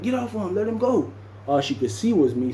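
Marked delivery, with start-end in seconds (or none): 0.0-1.1 higher, urgent plea to stop; pulling a fighter off, voiced higher; mock yell. Woman shouting at him to let go, higher.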